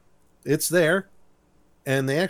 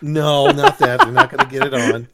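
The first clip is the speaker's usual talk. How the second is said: mock protest; 6 dB louder than usual. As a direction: protest in a rush, voice climbing. Acted protest to co-host's innuendo.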